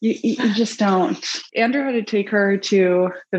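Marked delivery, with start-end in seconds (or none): none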